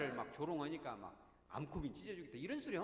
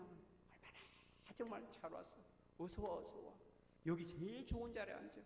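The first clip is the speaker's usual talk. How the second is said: tender fatherly welcome; 4 semitones higher, 4 dB quieter than usual. Acted. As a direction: welcome them home gently. God welcoming the returning sinner. (아버지처럼 다정하고 느리게 반김)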